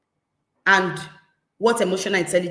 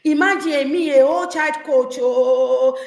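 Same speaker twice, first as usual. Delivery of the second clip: raised, louder indignant outburst; indignant parent; 5 semitones higher, 5 dB louder than usual. Retold or imitated. Parent embarrassed before guests, loud and indignant. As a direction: huff about being embarrassed in public. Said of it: "We will say".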